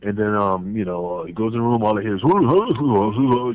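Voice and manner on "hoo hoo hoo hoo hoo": imitated grunting. Dutch hears the wrestler grunting behind the door. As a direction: pant out short hooting grunts. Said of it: "the grunting".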